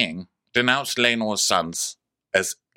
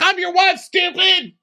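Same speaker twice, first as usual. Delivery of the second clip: much higher and louder, exasperated shout; furious mock yell; 5 dB louder, slower than usual. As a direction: scream it at a dense husband. Rhaenys scolding Corlys.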